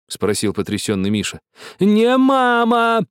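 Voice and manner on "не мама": high, slow, louder yell; shout. A narrator's reading. Yani shouting at shocked Misha, high drawn-out yell. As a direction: bellow it, slamming the point home. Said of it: "закричал Яни".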